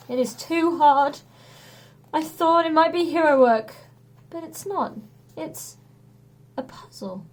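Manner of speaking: a hint of a gloomy strongman; glum hero. A narrator's reading. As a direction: let the big man deflate. Hercules voiced in story.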